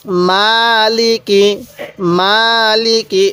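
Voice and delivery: মালিকি read very slowly, with মা held long and লি and কি kept short; slow drawn-out recitation. Shown as a demonstration. Teacher reciting মালিকি for students, slowly drawn out. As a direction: stretch only the মা in মালিকি. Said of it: "এরকমভাবে শিখব".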